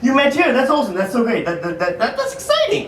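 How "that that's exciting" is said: high, sudden falsetto on exciting; mock excited squeak. Comedian feigning excitement at the couple's story, squeaky. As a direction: ham up the enthusiasm.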